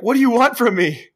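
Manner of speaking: high, loud mock desperation; retold frantic plea. Imitating Buckley on the hotline, frantic. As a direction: plead loudly as a panicked caller. Buckley pleads with mystery caller.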